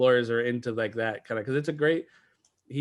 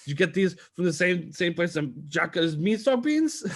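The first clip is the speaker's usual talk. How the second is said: mock courtroom voice; 5 semitones higher than usual. Riffing on the grits quote.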